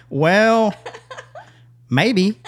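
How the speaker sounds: high, drawn-out, noncommittal reply; retold hesitation. Wary answer, unlike past eager one.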